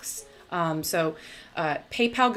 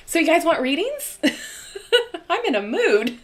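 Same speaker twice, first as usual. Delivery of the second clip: giggly; 10 semitones higher, 5 dB louder than usual. Real, not acted. Giggly offer of readings.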